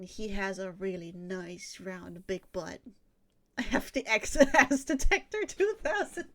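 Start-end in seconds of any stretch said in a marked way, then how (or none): 3.6-6.3 laughing through the words, higher and louder; cracking up. Real, not acted. Joking to friends about an ass detector, cracking up. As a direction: lose it laughing mid-sentence.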